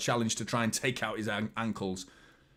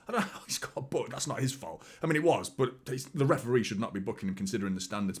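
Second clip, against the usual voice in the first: ordinary talk, nothing marked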